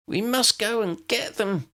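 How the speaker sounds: high, urgent near shriek; half-shrieking in alarm. Acted. Lydia pleading to rescue her friends, almost shrieking. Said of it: "Lydia was almost shrieking".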